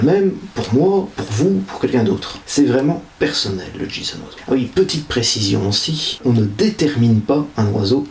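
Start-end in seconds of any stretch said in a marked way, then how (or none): 3.8-4.4 whispered, low and soft. Real, not acted. The host whispers the term to viewers like a secret. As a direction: breathe it like a secret.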